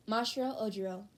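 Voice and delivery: usual speaking voice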